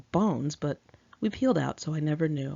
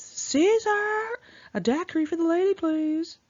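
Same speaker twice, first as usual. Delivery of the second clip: mock high call, fast, to the waiter; shrill voice; 12 semitones higher, 3 dB louder than usual. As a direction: squeal it up high like a show-off summoning the waiter. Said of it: "in a shrill voice".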